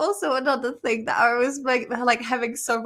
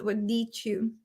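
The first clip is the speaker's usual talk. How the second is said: normal talking voice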